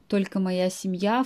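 normal talking voice

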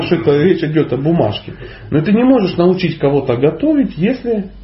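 normal talking voice

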